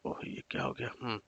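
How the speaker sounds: soft under-breath surprise; muttered aside. Real, not acted. Teacher puzzled by the screen going wrong, muttered under breath. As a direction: mutter it to yourself at the glitch. Screen glitch during lesson.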